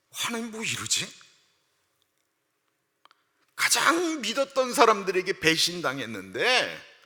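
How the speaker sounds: high, exasperated question to God; a disbelieving complaint; indignant protest. Retold or imitated. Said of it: "예레미야의 심정으로". (하나님께 어이없다는 듯 높게 항의)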